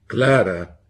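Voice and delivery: loud, severe calling of her name; sharp warning. A narrator's reading. Husband silencing his wife, loud and sharp. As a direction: cut her off with one word.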